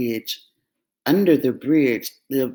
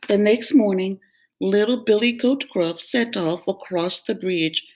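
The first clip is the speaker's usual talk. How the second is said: plain reading voice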